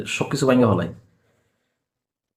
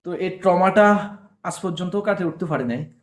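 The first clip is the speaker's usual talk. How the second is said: ordinary talk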